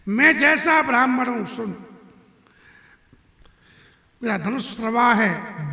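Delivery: loud, proud declamation of a warrior sage; boastful wrath. Acted. Parshuram warns Ram what kind of Brahmin he is, wrathful. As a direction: thunder out a menacing boast.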